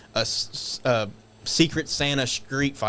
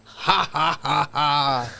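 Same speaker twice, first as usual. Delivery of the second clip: evil heel laugh; 4 dB louder, much slower than usual. Retold or imitated. Heel laughing after the attack.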